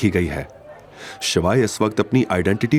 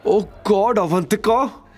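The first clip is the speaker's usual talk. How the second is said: higher, shrill-pitched scornful female voice; jealous accusing wife; 11 semitones higher than usual. A narrator's reading. Nandini accusing Avantika and Shivay, jealous and scornful. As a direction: accuse them with jealous contempt.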